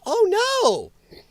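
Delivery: high-pitched fake "oh no"; mock dismay. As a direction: feign alarm in a silly high voice. Feigned dismay at good news.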